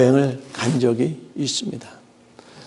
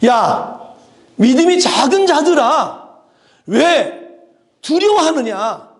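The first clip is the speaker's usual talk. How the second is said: high, loud scolding, slowing at the end; stern rebuke from the master; rebuking; 10 semitones higher, 6 dB louder, slower than usual. Acted. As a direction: scold the fearful disciples. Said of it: "꾸짖었어요".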